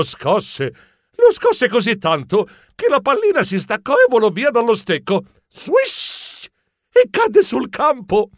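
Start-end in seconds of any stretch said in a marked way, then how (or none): 5.5-6.5 whooshing swish. Acted. Ice cream flies off stick.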